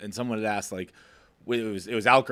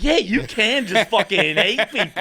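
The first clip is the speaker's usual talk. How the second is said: high, loud, slow and laughing; mock-cheering through laughter; laughing mock glee; 10 semitones higher, 5 dB louder, slower than usual. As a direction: gleefully ham it up while laughing. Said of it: "and you're like".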